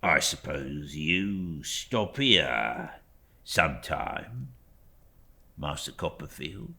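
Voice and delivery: low, slow, humble Uriah voice; lightly voiced humble clerk; Uriah Heep. A narrator's reading. Uriah speaking humbly to young David, low and slow.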